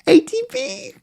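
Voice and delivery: high squeaky falsetto cry of the price; squealed price. Acted. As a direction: shriek the price in giddy disbelief.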